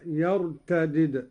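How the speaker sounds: يرتدد with two separate dals, "yartadid"; فك الإدغام. Shown as a demonstration. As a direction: split the dals of يرتدد, first with i, last vowelless. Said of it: "على فك الإدغام". (يرتدد بدالين، مكسورة ثم مجزومة)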